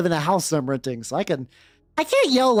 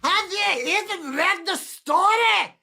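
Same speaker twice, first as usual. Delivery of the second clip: very high, slow screeching rant at the preps; angry goth yell; 11 semitones higher, slower than usual. Acted. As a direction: shriek it like a furious emo kid. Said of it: "do that voice".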